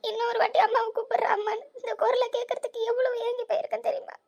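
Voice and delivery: put-on high, crying voice of a mother begging to hear her child; tearful plea. Mother reunites with lost daughter.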